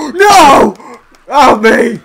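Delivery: high, very loud cry; wailing shout. Real, not acted. Player hit in the game after laughing, wailing shout. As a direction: wail in mock agony.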